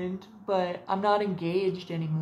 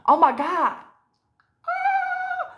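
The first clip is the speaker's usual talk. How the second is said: high squeal, louder; mock delighted shriek of a girl; 7 dB louder, much slower than usual. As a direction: girly squeal of delight, much higher. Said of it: "it's like".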